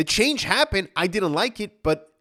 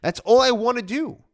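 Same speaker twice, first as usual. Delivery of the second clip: normal talking voice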